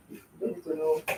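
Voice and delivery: a private whispered aside. Shown as a demonstration. Aunty brushing off the burger question, whispering to herself. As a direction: whisper "You don't need to know" to yourself.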